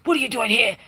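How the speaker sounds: hostile locals. Retold or imitated. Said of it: "that was their energy".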